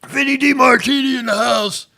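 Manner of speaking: high, loud hype announcement; hype shout-out. Real, not acted. Host hyping a viewer arriving, loud high shout-out. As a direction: shout the name like a hype man.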